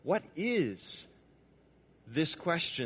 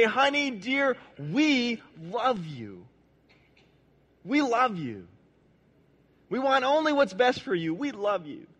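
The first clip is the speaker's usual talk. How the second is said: loving parents; 6 semitones higher than usual. Acted. Parents voiced tenderly.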